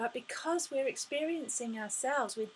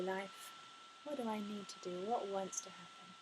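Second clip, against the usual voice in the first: soft asking; 6 dB quieter than usual. Shown as a demonstration. Softly asking life for guidance.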